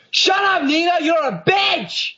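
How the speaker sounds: high-pitched mock shouting; angry brother's yell. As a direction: yell back at a sister, hammed-up. Nick yells back at sister.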